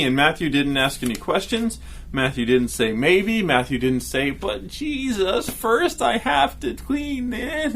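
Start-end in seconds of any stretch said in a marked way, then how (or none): 4.3-7.7 whiny excuse. Pretend. Voicing a reluctant disciple.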